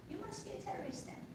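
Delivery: ordinary talk, nothing marked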